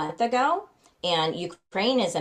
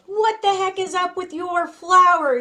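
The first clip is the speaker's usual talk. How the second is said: put-on much higher and louder, a riled-up old Irishman; indignant old Irish trainer, pitched way up; mad little Irish guy; 12 semitones higher, 8 dB louder than usual. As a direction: bark it out like a cranky Irish horse trainer. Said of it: "like, mad, you know, and he had a little temper".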